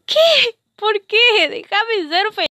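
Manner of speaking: high, loud outburst of disbelief; exasperated protest. Acted. Girl protesting to relatives who police her play, exasperated. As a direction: cry out in exasperation, pitch jumping up.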